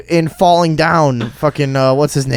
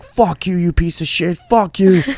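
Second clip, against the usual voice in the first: mild mock yell; 6 semitones higher than usual. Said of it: "so I'm screaming at this guy".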